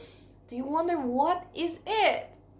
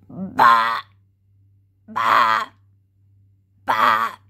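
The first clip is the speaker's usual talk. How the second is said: loud, lower bleating shout; shouted goat bleat; 6 semitones lower, 11 dB louder, much slower than usual. Retold or imitated. Goat sound for the children, shouted bleat. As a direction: belt out a goat's baa. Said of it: "a goat says".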